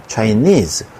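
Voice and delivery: 'Chinese' with stress on the last syllable nese; stress at end. Shown as a demonstration. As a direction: hit '-nese' in 'Chinese', falling. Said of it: "강세가 뒤쪽에 있습니다". ('Chinese' 뒷음절 강세)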